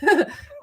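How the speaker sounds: quick bright laugh, caught out; embarrassed laugh. Real, not acted. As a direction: laugh it off, a little sheepish. Embarrassed by the journal question.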